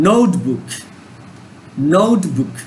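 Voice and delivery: Notebook with নোট hit hard; stress on NOTE. Shown as a demonstration. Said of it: "নোটের উপর এখানে জোর দেওয়া হয়েছে".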